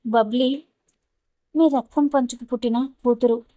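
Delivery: ordinary delivery, nothing marked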